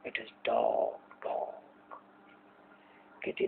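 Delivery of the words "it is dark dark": breathy hush, barely voiced; hushed dark. Teacher reading the poem to students, hushed and unvoiced. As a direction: let the darkness sink into a hush.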